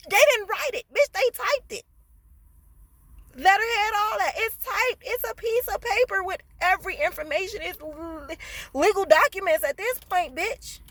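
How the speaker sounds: high, loud insistence that it's all typed; indignant rant. Real, not acted. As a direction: rant like the proof is in your hand. Ranting about typed paper proof.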